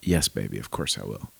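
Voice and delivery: low, soft reassuring reply; tender dad. Acted. Dad answering his daughter's call, low and tender. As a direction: gently soothe a worried daughter.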